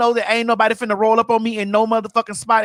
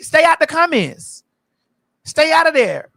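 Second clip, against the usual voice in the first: louder and higher, telling them off; scolding; 6 semitones higher, 6 dB louder than usual. Real, not acted. Speaker calling out former friends online, louder scolding. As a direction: warn them off firmly.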